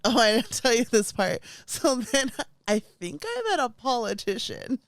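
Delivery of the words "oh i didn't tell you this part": ordinary delivery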